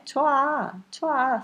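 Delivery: choa dito alto, juntando o e a numa sílaba; choa, curto, vogais fundidas; contracted form. Shown as a demonstration. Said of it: "Certeza que vocês já escutaram isso". (pronúncia contraída choa em tom alto)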